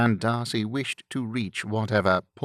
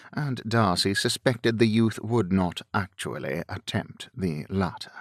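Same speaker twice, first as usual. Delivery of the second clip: usual speaking voice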